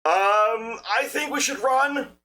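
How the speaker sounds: high, loud frightened squeak; panicked yelp. Acted. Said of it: "Tendy says".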